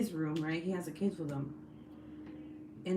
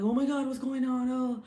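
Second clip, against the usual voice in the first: higher-pitched husband impression; mock husband voice; 6 semitones higher, 4 dB louder than usual. Husband reacting to wife's yelling, mock husband voice. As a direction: put on your silly voice for a startled husband. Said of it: "why his voiceover is like that".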